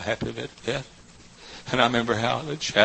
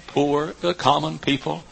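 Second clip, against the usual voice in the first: ordinary talk, nothing marked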